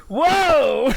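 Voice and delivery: loud, high imitation of a startled doctor; doctor's alarmed whoa. Retelling the doctor seeing the reading, loudly startled. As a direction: blurt it out in mock alarm. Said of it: "And the doctor went".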